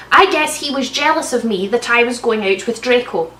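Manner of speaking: ordinary talk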